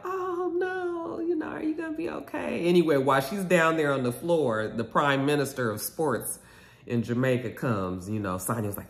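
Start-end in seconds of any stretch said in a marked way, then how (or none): 0.1-2.6 much higher, softer sing-song fake sympathy; mock concern. The cast fussing over Drew on the ground, in mock high sympathy. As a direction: coo it like overdone pity.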